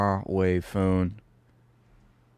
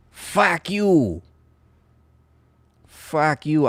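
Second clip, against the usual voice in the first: higher, drawn-out Boston curse; Boston accent; 5 semitones higher, much slower than usual. Pretend. Streamer cursing viewer who agreed with girlfriend, Boston accent. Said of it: "I had to do that in Boston".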